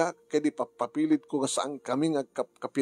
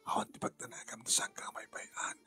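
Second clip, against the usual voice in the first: voiceless, much softer reassurance; soft whisper; 2 semitones lower, 12 dB quieter than usual. Acted. Daniel whispering comfort to Gina. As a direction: whisper a promise close.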